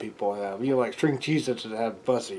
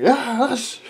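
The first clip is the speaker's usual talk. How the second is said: laughing cheer; 11 semitones higher, 4 dB louder than usual. Acted. Crowd cheering.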